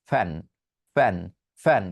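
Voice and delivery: ফ্যান opened with aspirated p; two-lip ফ্যান. Shown as a demonstration. Teacher demonstrating learners' habit, Bengali two-lip ফ্যান. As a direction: say each ফ্যান separately, lips closing first. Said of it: "দুইটা ঠোঁট লাগিয়ে উচ্চারণ করছেন".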